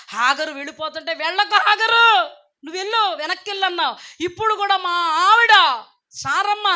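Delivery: Abraham crying out in grief as he begs; a father wailing not to lose his son; weeping prayer. Acted. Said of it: "మిక్కిలి దుఃఖంతో ఏడుస్తున్నాడు".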